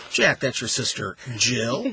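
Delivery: "Jill" punched with a questioning rise; stress on JILL. Shown as a demonstration. Host showing how emphasis changes meaning, stress on "Jill". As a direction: run up to "Jill" and hit it, rising. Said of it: "by the way that we emphasize".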